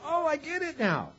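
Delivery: disciples getting it. Retold or imitated. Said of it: "The guys are going".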